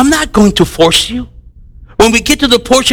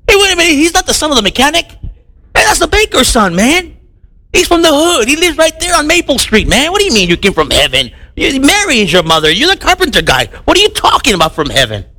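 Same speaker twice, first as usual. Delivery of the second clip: higher, fast mocking townsfolk; scoffing neighbor; 6 semitones higher, faster than usual. Skeptical crowd scoffing at Jesus, mock higher voice. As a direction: scoff like a know-it-all neighbor.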